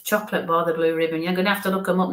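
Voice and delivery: usual speaking voice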